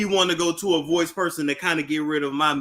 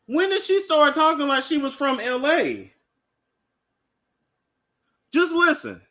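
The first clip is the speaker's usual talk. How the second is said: high incredulous questioning; who is this woman; 9 semitones higher than usual. Real, not acted. Host questioning Nene's new L A voice, high and incredulous.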